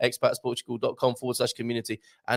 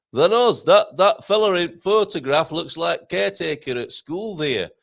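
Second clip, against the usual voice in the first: broad Yorkshire caller, louder and higher; Yorkshire accent; 5 semitones higher, 6 dB louder than usual. Retold or imitated. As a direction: do a blunt Sheffield local in broad Yorkshire. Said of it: "That's what they talk like in Yorkshire".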